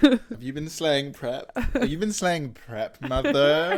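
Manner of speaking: high, slow, teasing diva voice; mock slay talk. Asking mother about slaying prep, hammed-up diva. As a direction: ham it up, soaring on the last word.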